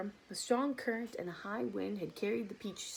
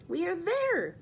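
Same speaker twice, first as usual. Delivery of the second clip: high, loud, bright announcement; cheerful announcement; 11 semitones higher, 5 dB louder, slower than usual. Acted. Grasshopper tells friends they arrived, high and loud. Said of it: "trying to be cheerful".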